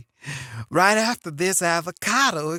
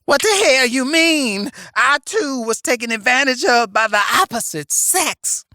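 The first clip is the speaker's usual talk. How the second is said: sassy grandma voice; 4 semitones higher, 6 dB louder than usual. Acted. Plays Medea character.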